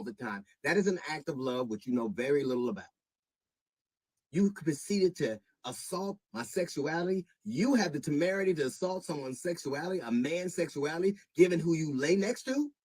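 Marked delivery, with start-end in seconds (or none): 4.3-12.7 rising anger, higher and louder by the end; sharp, wounded rebuke to Monique; indignant. Real, not acted. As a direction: bristle with offended anger. Confronting Monique over insults.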